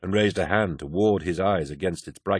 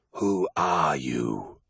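low, very slow, otherworldly wolf; a ghostly wolf questioning the lost hero; eerie wolf voice; 4 semitones lower, 4 dB quieter, much slower than usual. Acted. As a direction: draw each word out like a spectral beast. Wolf speaks to Horus.

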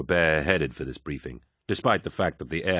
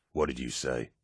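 menacing question; 4 semitones lower, 6 dB quieter than usual. A narrator's reading. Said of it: "Loken asked".

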